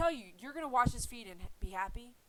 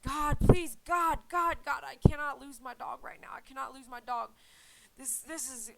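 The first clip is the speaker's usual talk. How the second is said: panicked prayer; 3 semitones higher, 7 dB louder than usual. Retold or imitated. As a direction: beg God in panic. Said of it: "And I said".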